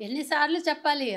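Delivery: loud impatient demand, as if fed up; retold director's shout. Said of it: "అదే గట్టిగా అరవడం అంటే".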